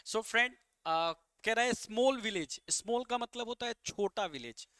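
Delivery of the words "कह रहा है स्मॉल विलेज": ordinary talk, nothing marked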